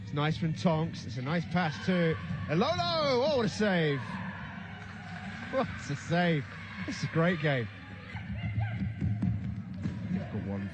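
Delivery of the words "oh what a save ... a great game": usual speaking voice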